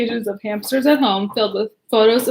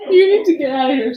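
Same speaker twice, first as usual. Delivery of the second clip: laughing; 3 semitones higher, 4 dB louder, faster than usual. Real, not acted. Laughing, shooing husband away.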